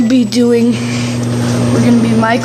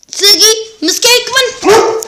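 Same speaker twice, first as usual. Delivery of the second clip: loud high call to the dogs; 8 semitones higher, 3 dB louder than usual. Real, not acted. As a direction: shout across the room. Calling the dogs.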